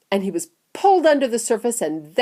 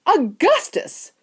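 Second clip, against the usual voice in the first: high, drawn-out cry of the name; slow, high-pitched call; 11 semitones higher, 3 dB louder, slower than usual. A narrator's reading. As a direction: cry the boy's name in dismay. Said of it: "cried Mister Wonka".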